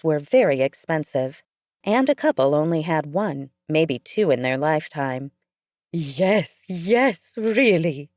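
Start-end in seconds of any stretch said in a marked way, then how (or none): 5.9-8.1 lightly teasing yes, yes, really; playfully mock Pippa's Really; half teasing. A narrator's reading. Queen Mob half teases the amazed Pippa. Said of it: "Queen Mob half teased".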